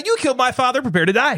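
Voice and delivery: big, bouncy broadcaster greeting; radio host voice. Shown as a demonstration. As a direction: greet them like a radio personality. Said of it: "Radio [name]".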